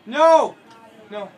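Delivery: sudden loud high yelp of dismay; frustrated yell. Real, not acted. Gamer yells at a wrecked build. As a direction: shout as the build breaks. Game mishap, sudden outburst.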